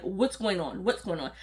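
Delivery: low, hushed, restless mumble; fretting quietly to herself; antsy passenger muttering under her breath; muttered antsy fretting. Retold or imitated. Antsy after three hours flying.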